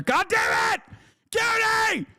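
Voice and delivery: high yelled complaint at a friend; mock-furious yelling. Streamer yelling at his friend Cutie for telling the truth, high shout. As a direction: shout at her in hammed-up betrayal.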